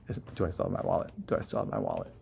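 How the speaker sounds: fast, hushed worried mutter; hushed nervous self-check about the wallet; anxious whisper. Retold or imitated.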